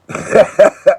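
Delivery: short high amused laugh. Real, not acted. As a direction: laugh at the polite twang. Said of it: "this guy sounds so polite".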